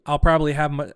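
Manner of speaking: ordinary talk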